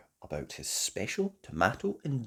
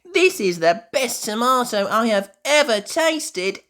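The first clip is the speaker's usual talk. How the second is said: high, loud, thrilled tasting; delighted Peppa; 14 semitones higher, 11 dB louder, slower than usual. A narrator's reading. Peppa tastes a tomato and raves, high and delighted. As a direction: rave about the tomato. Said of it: "says Peppa, tasting one".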